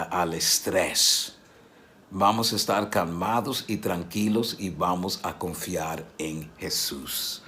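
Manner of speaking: calm a worried friend quietly; soft encouragement. Pretend. Friend helping someone back to Jesus, soft and calm.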